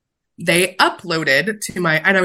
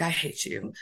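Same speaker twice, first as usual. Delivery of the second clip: mock muttered hate; 13 dB quieter than usual. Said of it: "And I'm like".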